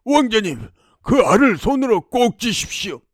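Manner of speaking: urging helper. Acted. Wolf tells prince to squeeze the egg, urgent. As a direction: urge him to act at once.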